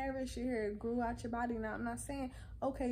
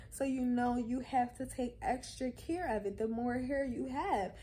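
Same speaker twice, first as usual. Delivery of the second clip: ordinary delivery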